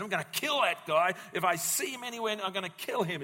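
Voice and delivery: loud threatening queen; murderous Jezebel. Acted. Queen Jezebel vowing to kill Elijah, loud and menacing. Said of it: "and she's saying".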